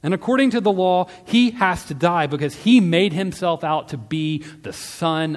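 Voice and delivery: loud, high, insistent accusation; yelling back. A narrator's reading. Priests accusing Jesus before Pilate, insistent shouting. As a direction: keep hammering the accusation at high volume. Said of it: "they yelled back".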